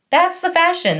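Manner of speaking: a self-satisfied salesman; smug manager. Retold or imitated. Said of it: "the manager says with his he, he, he laugh".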